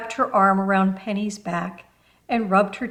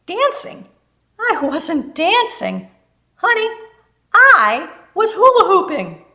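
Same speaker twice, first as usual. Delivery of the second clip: much higher and louder, laughing disbelief; amused exclamation; 10 semitones higher, 10 dB louder than usual. Acted. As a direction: correct her with delighted indignation. Amused correction after laughing.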